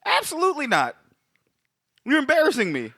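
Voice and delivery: high-pitched, incredulous mock indignation; mock outrage. Host scolding co-host over his awful accent, high mock outrage. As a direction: squeal your disbelief, then refuse flatly.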